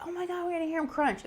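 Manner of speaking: high grossed-out squeal; mock disgust, voice way up; grossed-out exclaim. Family recoiling at the disposal idea, high grossed-out squeal.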